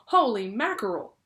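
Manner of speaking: loud startled exclamation; surprised cry. A narrator's reading. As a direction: blurt it out in shock. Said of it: "who was almost as surprised".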